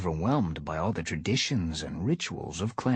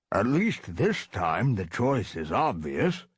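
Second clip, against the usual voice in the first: put-on old tomcat voice; 3 semitones higher, slower than usual. Old tom voice.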